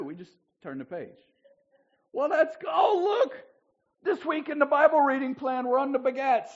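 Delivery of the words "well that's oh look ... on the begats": loud, very high hammed-up delight; mock excitement. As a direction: shout it like a thrilled Bible reader let off homework. Acting out readers glad to skip.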